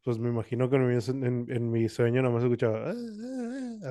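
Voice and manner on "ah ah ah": very soft, high imitated whimper; faint, thin squeal of someone trying to scream asleep; tiny strangled moan. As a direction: squeeze out a little high "ah". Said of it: "algo así poquito".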